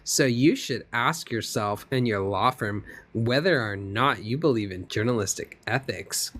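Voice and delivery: normal talking voice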